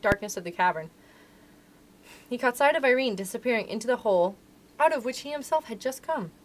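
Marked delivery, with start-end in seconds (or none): none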